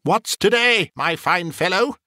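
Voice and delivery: high-pitched, jolly question; eager calling. Acted. Scrooge asks the boy below, calling eagerly. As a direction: hail the youngster cheerfully from above.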